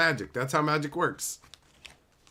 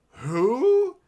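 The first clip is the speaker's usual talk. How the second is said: high, loud, rising mock bafflement; clueless PR guy; 11 semitones higher, 7 dB louder than usual. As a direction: imitate someone with absolutely no idea. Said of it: "the P R guy's like".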